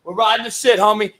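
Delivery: ordinary delivery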